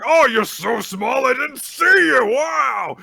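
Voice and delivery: loud, high mocking holler; over-the-top taunting shout; bombastic big guy. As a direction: ham it up as a huge, boisterous brute. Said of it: "one of those very bombastic big guys".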